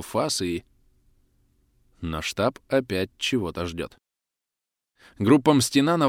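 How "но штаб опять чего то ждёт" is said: plain reading voice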